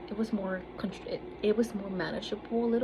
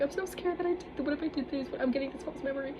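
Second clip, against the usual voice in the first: much higher, frantic and fast; high panicked retelling; 7 semitones higher than usual. Retold or imitated. Texting her therapist in a panic, high and frantic. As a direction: squeak out the panic in a rush. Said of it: "I was like".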